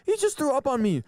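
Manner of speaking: put-on girl's high indignant whine; imitating the girl. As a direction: squeal it like the upset girl. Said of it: "She's like".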